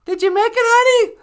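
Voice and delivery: very high, loud calling voice; sweetie character. Acted. Sweetie checking the traveller arrived, high loud put-on voice. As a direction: shout after them in a squeaky voice. Sweetie calls to the teleported partner.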